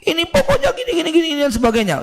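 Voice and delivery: high, rushed mock-panicked mother; flustered mum gabbling at dad. Mother panicking over the school bill, mock flustered. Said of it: "Udah panik".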